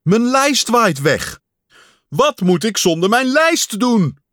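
high and loud, in distress; desperate loud wail about the list; panicked calling. A narrator's reading. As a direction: keep shouting in alarm. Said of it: "riep Pat".

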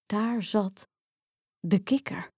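usual speaking voice

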